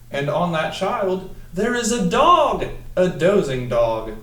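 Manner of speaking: big storybook voice. Acted. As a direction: make the dog a big moment for the kids. Building read-aloud, dog appears.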